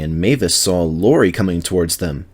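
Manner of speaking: ordinary delivery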